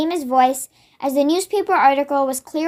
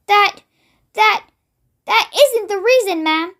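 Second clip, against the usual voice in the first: a touch of stammering fear, higher; quivering voice; 5 semitones higher than usual. Acted. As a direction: shaken maid stumbling over her words. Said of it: "The maid's voice was quivering terribly".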